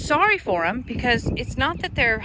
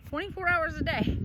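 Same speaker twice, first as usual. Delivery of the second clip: higher, disbelieving repeat of "Twenty-four hours a day"; incredulous echo; 6 semitones higher than usual. Shown as a demonstration. Vlogger repeating her mom's claim about barking dogs, incredulous.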